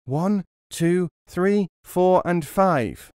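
shouted high count; loud echo. A narrator's reading. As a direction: chime in as loud as possible. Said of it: "as loud as he was able".

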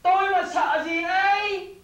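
high, slow, drawn-out bluster; indignant boast. Acted. Accused of being scared himself, indignant boast. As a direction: puff it up loudly as if insulted.

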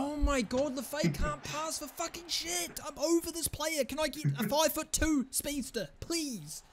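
high-pitched exasperated rant; exasperated complaint. Real, not acted. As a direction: whine in high-pitched despair at your player. Fed up with his game player.

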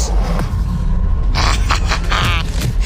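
loud, breathy burst of laughter. Real, not acted. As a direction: explode into laughter. Loud laugh after success.

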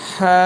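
হা held long from the middle of the throat; drawn-out pharyngeal হা; mid-throat letter. Shown as a demonstration. Teacher demonstrating the throat letter, long mid-throat হা. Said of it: "গলার মাঝখান থেকে".

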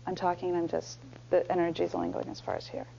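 soft, low, swallowed voice; low-energy mumble. Shown as a demonstration. Coach showing weak energy that stops short, mumbled. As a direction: let the words drop right in front of you. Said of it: "my energy to land here like".

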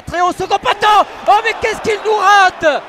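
ordinary talk, nothing marked

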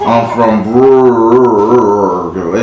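put-on very slow, swallowed-letter Brooklyn accent; mock Brooklyn accent. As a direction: drag out Brooklyn like a New Yorker. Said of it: "y'all B's turn to G's".

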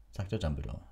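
ordinary delivery, nothing marked

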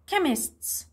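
"Chemists" with the first syllable stressed. Shown as a demonstration. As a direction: hit the opening of "Chemists", let the end fall. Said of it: "اللي المس بتركز عليه".

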